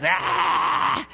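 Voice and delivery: high, wild "aah" noise; an imitated gleeful-frenzy shriek; mock kid's yell. Kid ripping up the grass, mock high yell.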